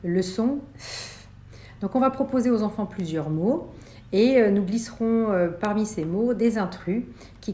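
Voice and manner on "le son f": F said slowly, the f held long; teacher modelling the sound F slowly; drawn-out F sound. Shown as a demonstration. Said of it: "d'identifier si un mot contient ou non".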